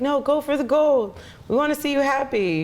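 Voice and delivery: high, slow cheerleader voice egging her on; cheering friends. Retold or imitated. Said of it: "They're like".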